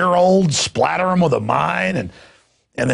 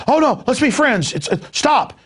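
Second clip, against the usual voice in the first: mock pleading soldier; 10 semitones higher than usual. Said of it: "the German goes".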